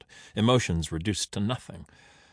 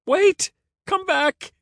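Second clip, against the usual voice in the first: very high, loud desperate call; calling after; 7 dB louder, slower than usual. A narrator's reading. Caesar calling back the vanished Render, high shout. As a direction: shriek it after someone leaving.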